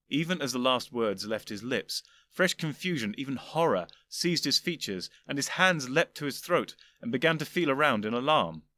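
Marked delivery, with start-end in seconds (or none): none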